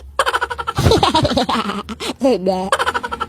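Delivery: quiet giggling laugh; a hushed, amused laugh; soft laugh. Acted. Child laughing. (mahinang tawa)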